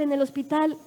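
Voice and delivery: ordinary delivery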